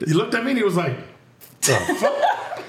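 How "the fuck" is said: high, laughing mock judge double take; a judge staring in disbelief, squeaking it out with a laugh; judge's baffled reaction. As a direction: replay the judge's stunned disbelief through a laugh. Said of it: "Wait, do that again".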